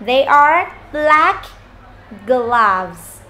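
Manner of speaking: slow, clear teacher demo; slow model sentence. Shown as a demonstration. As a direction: spell it out slowly for the class. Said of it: "chúng ta sẽ nói này".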